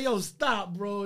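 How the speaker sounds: high, loud, laughing disbelief; amazed protest. Retold or imitated. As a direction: reenact a stunned shout to a friend. Repeats the stunned reply.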